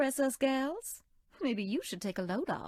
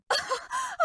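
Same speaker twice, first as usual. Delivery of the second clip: shrieking tickled laugh. Acted. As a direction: squeal with laughter while being tickled. Tickled by the player.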